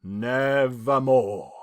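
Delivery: a slow, low answer from the raven; raven's reply. Acted. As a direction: intone it slowly like the dark bird. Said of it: "Quoth the raven".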